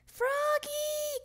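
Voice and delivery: high, drawn-out call from another room. A narrator's reading. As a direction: sing out the name loudly, stretched high. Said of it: "called Mom".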